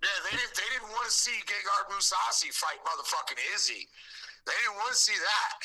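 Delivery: high, heated protest; indignant outburst. Real, not acted. Fan arguing they dodged the Gegard and Izzy fight, indignant. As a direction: insist on it, pitched well up.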